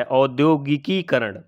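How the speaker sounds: औद्योगिकीकरण read slowly with the Kiki clearly apart; slow careful reading. Shown as a demonstration. Teacher reads the correct word from the board, slowly. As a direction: read औद्योगिकीकरण slowly, each syllable distinct. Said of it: "यहाँ पर क्या लिखा है?".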